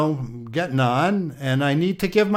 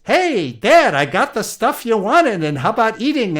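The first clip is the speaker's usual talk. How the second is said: higher, louder son calling to his father; Esau; 7 semitones higher, 4 dB louder than usual. Acted. Firstborn back with food for his father, lightly voiced hearty call. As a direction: hail Dad eagerly, pitched up throughout. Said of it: "in Esau's voice".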